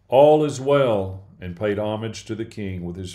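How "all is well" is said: louder, slow call across the distance. A narrator's reading. Messenger calling out to the king, loud and slow. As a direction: draw it out as a proclamation. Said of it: "Ahimaaz called out to the king".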